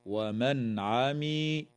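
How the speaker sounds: "ومن عمي" very slowly, the noon said cleanly before the ain; slow tajweed reading of "ومن عمي" with a clear noon; clear noon recitation. Shown as a demonstration. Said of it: "تظهر النون الساكنة عند العين".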